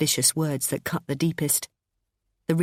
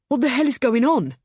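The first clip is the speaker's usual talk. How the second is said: alarmed; 8 semitones higher, 5 dB louder than usual. A narrator's reading. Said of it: "said James".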